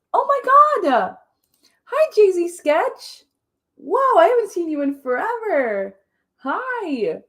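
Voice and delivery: high, drawn-out happy hello; excited greeting. Real, not acted. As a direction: greet them with a high, loud burst of delight. Greeting a viewer joining stream.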